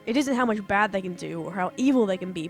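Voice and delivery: ordinary delivery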